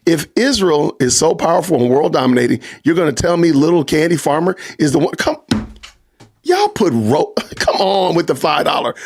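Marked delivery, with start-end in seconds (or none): none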